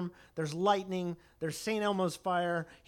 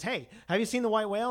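fast, higher, eager hail; mock Ahab; 6 semitones higher, 4 dB louder, faster than usual. Captain demanding news of the whale, fast and eager. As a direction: blurt it out like a madman.

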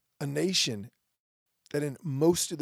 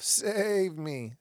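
louder, higher mocking plea; showing how the cry sounds when said loud, louder and higher; 6 semitones higher, 5 dB louder than usual. Shown as a demonstration. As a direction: belt out the plea like a crowd calling. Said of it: "Say it loud enough".

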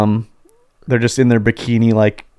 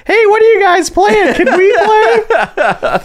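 put-on very high, slow kid voice; squeaky mock child asking to join; 4 dB louder, much slower than usual. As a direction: mimic a curious little kid. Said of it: "the kids come over, they're like".

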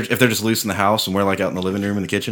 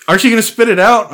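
daughter's voice; 10 semitones higher, 5 dB louder than usual. Retold or imitated. Daughter quoted, amused.

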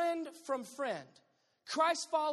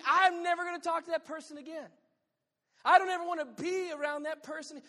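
loud, higher, fed-up vow never to speak again; 5 semitones higher, 8 dB louder, faster than usual. Retold or imitated. Preacher voices a hurt churchgoer swearing off a friend, loud and fed up. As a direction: swear off the gossiper, raised. Imagined angry reaction to gossip.

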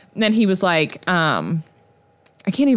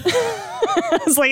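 laughing; 12 semitones higher, much slower than usual. Real, not acted. Laughing recalling trunk.

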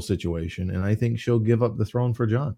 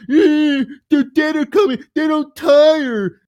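much higher, put-on sneering mimicry; much higher mocking parody of the warning; mocking whiny voice; 5 dB louder, slower than usual. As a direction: parrot the warning back to mock it. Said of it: "you're just like".

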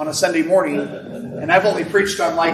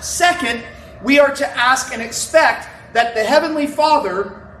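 rising, emphatic sermon voice, higher; preaching; 5 semitones higher than usual. Real, not acted. Pastor preaching to the congregation on Holy Spirit, emphatic. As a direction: preach it with building conviction. Preaching builds through the verse.

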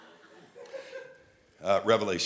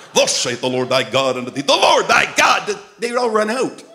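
put-on old-style prophesying; 7 semitones higher, 10 dB louder than usual. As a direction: thunder it out like an old-time prophet. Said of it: "we all used to prophesy like that".

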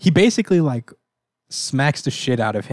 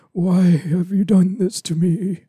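mock pitiful plea; 7 semitones higher, slower than usual. Beaten Galactus begging on his knees.

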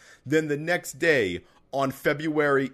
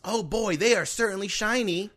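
put-on high awed kid voice; wide-eyed kid admiring; child voice; 6 semitones higher than usual. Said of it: "sent us a recording".

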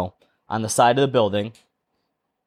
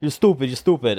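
imitated Puerto Rican man losing it; agitated Puerto Rican accent, repetitive; flying off the handle. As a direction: rattle off insults in a mock Puerto Rican accent. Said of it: "he's flying off the handle".

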